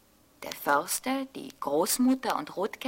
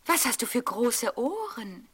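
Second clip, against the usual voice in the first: put-on higher, wondering little girl; a curious child asking; 4 semitones higher than usual. A narrator's reading. Rotkäppchen voice. (Rotkäppchen fragt erstaunt mit hoher Mädchenstimme)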